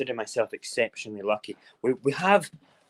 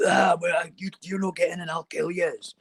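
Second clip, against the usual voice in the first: raised, higher-pitched mock raging; ranting threat; 7 semitones higher than usual. Neighbour raging at police in siege, mock shouted threat. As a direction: imitate a furious man threatening police. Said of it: "his tone totally changed".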